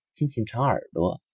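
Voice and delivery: ordinary delivery, nothing marked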